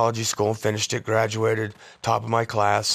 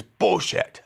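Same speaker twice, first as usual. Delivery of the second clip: higher, defiant mock retort; 8 semitones higher, 4 dB louder than usual. Dad to boy about parking, mock defiant retort. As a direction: imitate a dad brushing off a rule.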